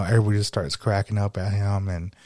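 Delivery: ordinary talk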